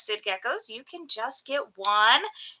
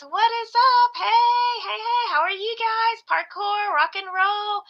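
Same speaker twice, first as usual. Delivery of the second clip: upbeat live-stream shout-out; excited greeting; 8 semitones higher than usual. Real, not acted. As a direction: welcome the chat with a burst of energy.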